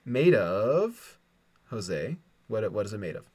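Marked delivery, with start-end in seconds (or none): none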